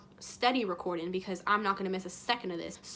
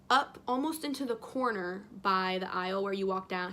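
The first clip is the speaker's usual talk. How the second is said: usual speaking voice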